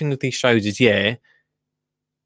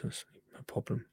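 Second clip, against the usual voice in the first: whispered aside; 18 dB quieter than usual. Real, not acted. Hushed admission.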